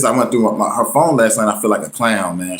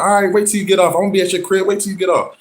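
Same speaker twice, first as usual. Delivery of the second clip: high, taunting warning; mock threatening; 9 semitones higher than usual. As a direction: imitate her promising to pull up. Ex threatens to come over.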